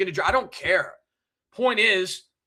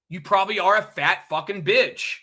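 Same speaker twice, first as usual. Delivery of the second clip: ordinary talk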